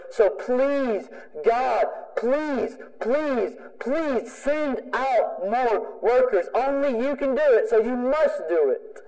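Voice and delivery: high-pitched, beseeching plea to God; begging prayer. Shown as a demonstration. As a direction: implore desperately, drawing out each please. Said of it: "our prayer should sound something like this".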